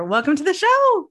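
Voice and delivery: high, loud beaming welcome; excited welcome. Real, not acted. Host welcoming her guest to the show, loud and high. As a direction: throw the welcome out big and bright.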